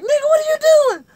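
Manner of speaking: shrieking, very high and fast; piercing hammed-up alarm; scared protest. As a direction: act out a panicked woman scolding. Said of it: "she was scared to get out".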